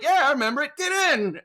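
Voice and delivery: high, loud, pushy invitation; playfully demanding; mock eager shout. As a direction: bark it way up high, hammed up. Mock retort to comedian.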